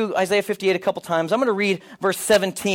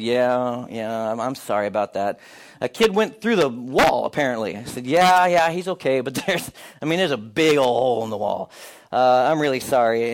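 sheepish apology; 7 semitones lower than usual. Retold or imitated. Sheepish apology to senior pastor.

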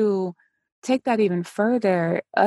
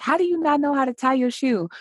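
exasperated parent; 8 semitones higher, faster than usual. Retold or imitated. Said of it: "it's like".

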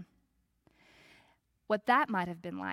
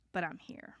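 whisper; 2 semitones lower, 7 dB quieter than usual. Acted. Voicing faithful resolve.

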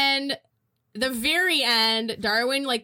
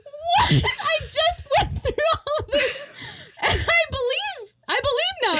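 high, laughing outburst of disbelief; mock indignation. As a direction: protest in a high voice, half cracking up. Acting out Frances's outraged protest.

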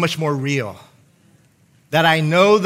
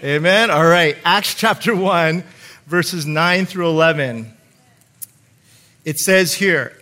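usual speaking voice

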